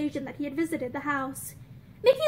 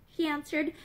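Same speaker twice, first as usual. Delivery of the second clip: usual speaking voice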